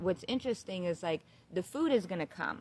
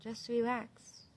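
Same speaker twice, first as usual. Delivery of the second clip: soothing divine voice; 3 semitones higher, 6 dB quieter, slower than usual. Retold or imitated. The divine calming her mid-fit, soothing. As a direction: soothe gently, soft and drawn out. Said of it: "I kept hearing divine saying".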